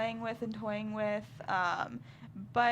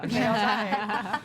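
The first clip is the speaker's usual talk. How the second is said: laughing; 8 dB louder, much slower than usual. Real, not acted. Repeating joke with laugh.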